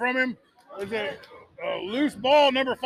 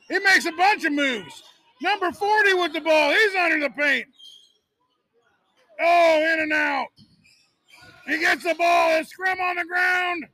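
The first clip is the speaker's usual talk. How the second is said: rising, louder and higher live call; excited play-by-play; 6 semitones higher, 5 dB louder than usual. Real, not acted. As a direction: yell the action as it happens. Fast break, ball loose under basket.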